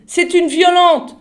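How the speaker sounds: loud, high, urgent cry; shouted warning. A narrator's reading. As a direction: call out the danger at the top of your voice. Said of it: "cria Jeeves".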